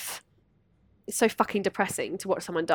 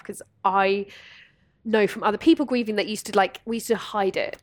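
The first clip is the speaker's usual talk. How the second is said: usual speaking voice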